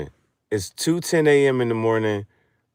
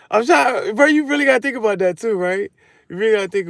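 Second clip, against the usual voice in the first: much higher, louder and fast; excited rant; 12 semitones higher, 7 dB louder, faster than usual. Real, not acted. To viewers pressing the point, excited rant. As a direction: rush it in excitement.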